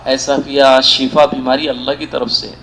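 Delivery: low, gentle reassurance; consoling. Retold or imitated. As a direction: soothe someone who is weeping. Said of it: "اللہ کے رسول کو دلاسا دینا پڑا".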